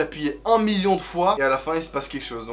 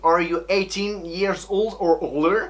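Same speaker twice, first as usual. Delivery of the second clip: slow American English reading; reading English aloud; slower than usual. Shown as a demonstration. Reading the app screen to viewers, slow English. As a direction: read the prompt out slowly in English.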